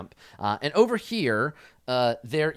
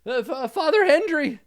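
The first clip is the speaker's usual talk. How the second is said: high, hesitant character voice; timid, high-pitched call to a superior; Fezzik; 6 dB louder than usual. Acted. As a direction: stammer for attention in a high voice. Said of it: "Fezzik is like".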